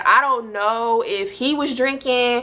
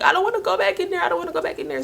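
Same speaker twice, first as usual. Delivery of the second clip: high, fast, tearful retold plea; crying plea; 8 semitones higher, much faster than usual. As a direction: beg through tears, voice pitched up. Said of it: "And I'm just like".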